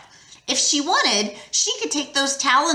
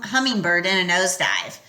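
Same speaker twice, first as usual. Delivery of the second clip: ordinary delivery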